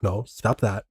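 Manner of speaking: mock panic, low and toneless; uninflected protest. Mimicking the man's fake panic, uninflected protest. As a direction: run off the protests softly without any alarm. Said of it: "there's no inflection to it".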